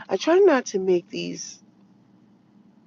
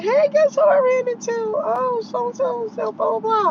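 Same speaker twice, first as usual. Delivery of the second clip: bubbly chatter; 13 semitones higher than usual. Retold or imitated. Retelling her own chatty greeting.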